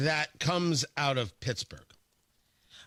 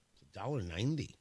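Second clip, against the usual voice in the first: muttered repeat, much softer and lower; quiet repeat; 7 semitones lower, 7 dB quieter than usual. Real, not acted. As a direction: say it again under your breath in disbelief.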